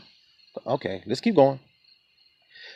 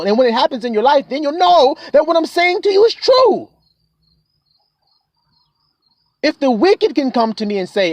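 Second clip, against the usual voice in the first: higher, pressing sermon voice building to the close; preaching at full force; 4 semitones higher than usual. Acted. Preacher urging the little flock to come and believe, fervent. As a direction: call the flock in with growing fervour.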